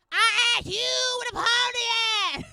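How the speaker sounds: high, loud exasperated yell; mock angry shout. Detroit lady repeating her question angrily.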